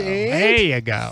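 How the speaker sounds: slow, drawn-out coaxing; coaxing the cat. Acted. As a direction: ease the words out slowly to settle a pet. Coaxing the cat onto the stand.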